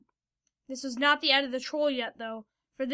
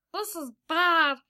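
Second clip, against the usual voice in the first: higher, slow, louder character voice; worried Kenzo; 4 semitones higher, 5 dB louder than usual. A narrator's reading. Kenzo thinking of angry Fido, worried high voice. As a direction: drag out the worry in a raised voice.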